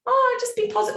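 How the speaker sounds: high, mock breezy cheer; breezy dismissal. Mocking a glib mentor, high and breezy. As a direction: chirp it off dismissively. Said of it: "I'm not like".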